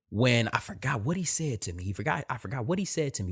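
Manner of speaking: ordinary delivery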